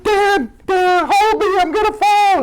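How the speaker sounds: high, frantic cry for help up the ladder; mock panicked plea. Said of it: "And I'm like".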